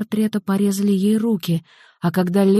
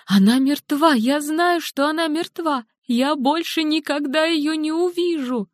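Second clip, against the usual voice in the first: high, desperate grieving woman; distraught mother; 9 semitones higher than usual. Acted. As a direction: voice her high and near breaking with grief. Helen grieving missing daughter.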